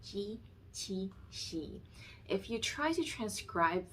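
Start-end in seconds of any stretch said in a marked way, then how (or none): 0.0-1.8 ji, qi, xi with flat tongue, voiceless, unaspirated; crisp Mandarin ji, qi, xi; Chinese ji qi xi. Shown as a demonstration. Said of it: "they're pronounced as".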